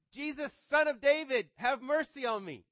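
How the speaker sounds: loud desperate plea for mercy, high; crying out. Acted. Blind beggar crying out to Jesus, loud pleading cry. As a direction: call out over a crowd, begging. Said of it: "Bartimaeus cries out".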